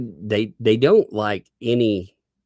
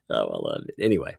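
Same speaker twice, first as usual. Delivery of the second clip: normal talking voice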